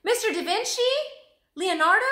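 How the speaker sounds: loud, high call across the market; shouting after him. A narrator's reading. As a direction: call out to stop him walking away. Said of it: "Annie shouted".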